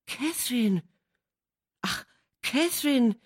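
put-on a shocked young man calling out to his beloved; dismayed Linton. A narrator's reading. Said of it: "warf Linton ein".

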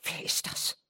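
faint, breathy whisper; breathed whisper. Acted. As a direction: barely breathe the question. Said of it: "hauchte die Mutter".

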